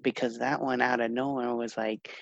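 hushed low voice; whispering. Real, not acted. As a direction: keep it down, secretive. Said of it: "Why, why are you whispering?".

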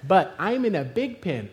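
normal talking voice

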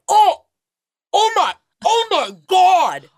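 high-pitched mock gasping alarm; shocked onlookers. Passengers in a stopped car seeing a fall, shocked. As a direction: imitate horrified bystanders, voice shooting up. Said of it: "all of them are like".

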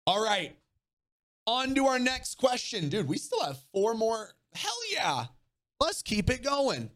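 bright and high, pumping up the chat; rally the viewers to keep going; high, hyped-up host voice. Real, not acted. Hypes up the next question.

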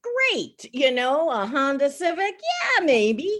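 high, loud, sing-song car list; mock enthusiasm. Host weighing cars for young buyers, mock gushing. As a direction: gush over cheap cars in a mock-bright voice.

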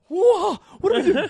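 scared and lost; alarmed. Retold or imitated. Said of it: "I look at Cody Ray".

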